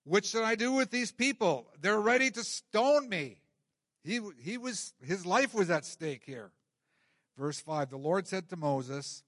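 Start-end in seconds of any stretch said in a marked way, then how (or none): 0.0-3.4 loud, higher-pitched acted plea; raised voice, pleading for help with an unruly crowd; crying out. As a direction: cry out to God in exasperation. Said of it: "He cried out to the Lord".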